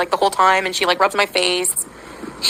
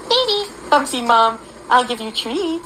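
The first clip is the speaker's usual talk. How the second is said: sing-song pet voice; cooing to dog; 4 semitones higher than usual. Real, not acted. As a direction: coax the dog sweetly. Talking to her dog.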